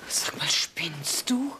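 slow, hushed disbelieving whisper; slow stunned whisper at her husband; shocked whisper. Acted. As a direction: breathe it out in disbelief. Wife caught accused of affair.

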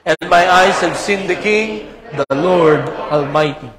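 loud, declaiming Bible reading; solemn public reading of scripture. Acted. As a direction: proclaim the passage like a preacher. Reads Isaiah scripture aloud.

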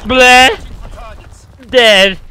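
loud comic bleh, then a falling dead; mock death noise. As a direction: play the game's death sound for laughs. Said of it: "It goes".

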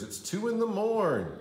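plain reading voice

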